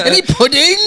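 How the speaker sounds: high, loud mock-British child voice; mock British accent. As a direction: join the bit in a playful British accent, laughing. Joins in the posh British bit.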